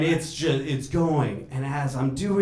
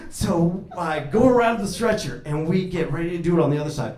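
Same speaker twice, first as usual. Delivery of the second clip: usual speaking voice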